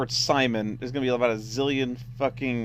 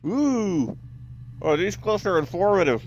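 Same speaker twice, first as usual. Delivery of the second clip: talking around a pen; words muffled by a pen held in the mouth; pen in teeth; 8 semitones higher, 4 dB louder, slower than usual. Real, not acted. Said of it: "Sorry, pen in my mouth".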